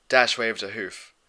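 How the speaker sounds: plain reading voice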